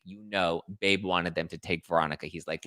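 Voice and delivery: ordinary talk, nothing marked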